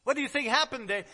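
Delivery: normal talking voice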